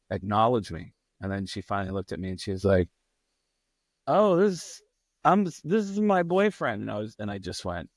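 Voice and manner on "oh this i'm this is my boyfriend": high-pitched imitation of a girlfriend; caught girlfriend. Girlfriend caught kissing a guy, awkwardly explaining. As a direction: stumble through an awkward introduction, pitched up.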